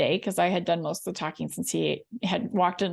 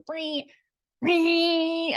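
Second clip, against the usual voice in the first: high drawn-out "ring, ring" mimicking a long honk; imitated horn blast; 8 semitones higher than usual. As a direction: lay on the horn with your voice. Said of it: "I put my shoulder into that honk".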